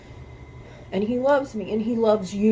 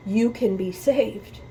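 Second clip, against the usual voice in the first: ordinary talk, nothing marked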